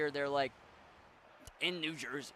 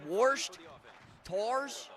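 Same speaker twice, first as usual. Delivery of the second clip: punched-out Yinzer vowels, higher pitched; Yinzer accent; 3 semitones higher, 3 dB louder than usual. Retold or imitated. Streamer mimicking mom's words, Yinzer accent. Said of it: "My mom talks like a Yinzer".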